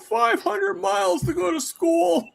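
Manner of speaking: high, slow shouted grandma rant; old grandma. Pretend. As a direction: lecture them like an old grandma.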